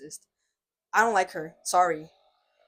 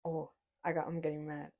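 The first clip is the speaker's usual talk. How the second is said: ordinary talk, nothing marked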